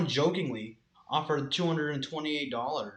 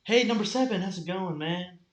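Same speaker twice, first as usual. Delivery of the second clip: higher, louder opening, easing off; mock hallway hail; 5 semitones higher, 3 dB louder than usual. Greeting number seven in the hallway, hammed-up hail.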